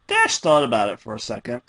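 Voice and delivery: ordinary delivery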